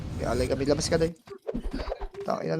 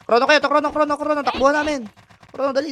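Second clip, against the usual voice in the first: higher, louder urgent team callout; urgent shouting; 8 semitones higher, 5 dB louder, faster than usual. Real, not acted. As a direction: shout the calls fast and urgent. Urgent game callouts to teammates.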